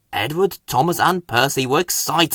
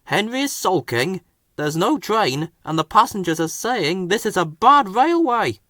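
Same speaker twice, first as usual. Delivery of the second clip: station master; 7 semitones higher than usual. A narrator's reading. Station master continues report.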